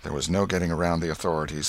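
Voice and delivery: ordinary delivery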